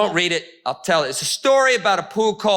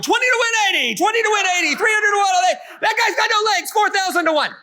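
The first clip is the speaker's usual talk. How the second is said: bookie calling odds; 13 semitones higher than usual. Retold or imitated. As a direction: bark the odds like a racetrack bookie. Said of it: "some bookie in heaven going".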